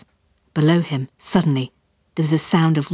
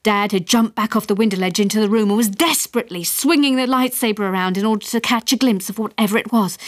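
usual speaking voice

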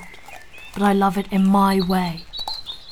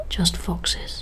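hushed whisper; 2 semitones lower, 5 dB quieter than usual. Acted. Whispering to a companion, brushing off a noise in the dark. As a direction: whisper it to calm a nervous companion.

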